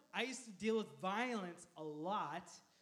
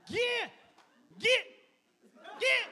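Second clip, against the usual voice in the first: short, high, loud mock yells; shooing shout; 13 semitones higher, 13 dB louder, slower than usual. Kansas-raised wife driving the man away, mock angry shouting. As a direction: yell each word like shooing someone off. Wife chases off driver, acted.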